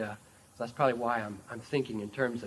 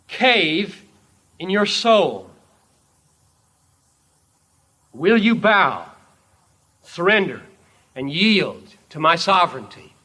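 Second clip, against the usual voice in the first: preacher lightly voicing a commanding king; sovereign king; 7 semitones higher, 13 dB louder than usual. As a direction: declare it as a sovereign, in emphatic phrases. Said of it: "He approaches as a sovereign, as a king".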